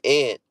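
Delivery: "aunt" said like the insect "ant"; short a of "cat" in "aunt"; ant pronunciation. Shown as a demonstration. Telling viewers what to put, "aunt" said as "ant". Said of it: "if you say it".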